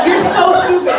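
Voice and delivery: loud, high, laughing put-down; a laughing yell at a stupid troll; exasperated jab. Real, not acted. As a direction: fling the insult with a laugh. Scolding the blue check man.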